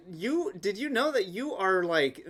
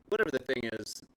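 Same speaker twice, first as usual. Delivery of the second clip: normal talking voice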